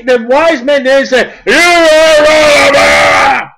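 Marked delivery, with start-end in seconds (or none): 1.5-3.5 slow booming accusation, higher and louder; acted shout. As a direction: drag each word out in a big shout. Acting out the wise men's rebuke.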